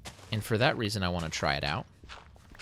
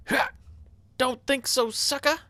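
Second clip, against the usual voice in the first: much higher, squeaky taunt at the zombies; mock the monsters in a silly high voice; taunting high cartoon voice; 4 dB louder than usual. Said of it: "That's what I'm gonna say".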